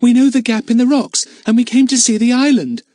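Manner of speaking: put-on high, loud indignant girl's voice; girl bristling with indignation. A narrator's reading. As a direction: protest like an offended girl. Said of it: "said Lucy Ann indignantly".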